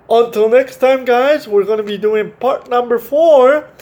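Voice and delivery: host hypes the next part, loud and high; excited sign-off. Real, not acted. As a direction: send them off with a big cheerful lift. Sign-off to viewers.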